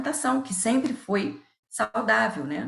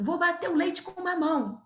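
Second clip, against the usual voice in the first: high mock yell, answering from the kitchen; retold husband's shout; 10 semitones higher than usual. Husband calling back to his wife, a mild mock yell. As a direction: play the husband calling back, lightly voiced. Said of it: "gritou assim".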